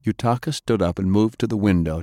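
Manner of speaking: plain reading voice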